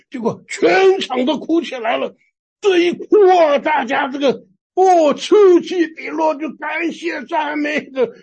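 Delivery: high, loud, impassioned storytelling; swept-up excitement. Retold or imitated. Preacher retelling how the whole congregation burst into tears, excitedly. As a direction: build to ringing, stretched-out cries of amazement.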